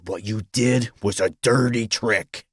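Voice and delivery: slow, pointed reproach; bitter accusation. A narrator's reading. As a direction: accuse him, drawing out each word. Rival accuses businessman in quarrel.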